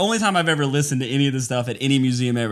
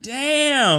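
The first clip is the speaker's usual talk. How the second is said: higher-pitched retold "Damn!"; mock amazement; 10 semitones higher than usual. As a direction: react like you're stunned by something ancient. Retold reaction at museum.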